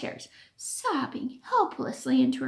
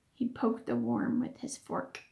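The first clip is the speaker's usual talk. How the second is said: ordinary delivery, nothing marked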